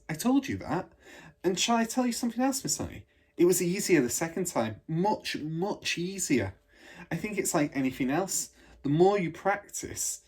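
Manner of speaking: put-on a girl savouring the memory of flying; delighted little girl. Matilda describing flying joyfully.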